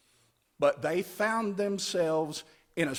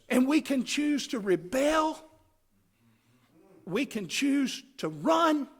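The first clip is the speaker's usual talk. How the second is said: preaching shout; 7 semitones higher than usual. Acted. Preaching climax.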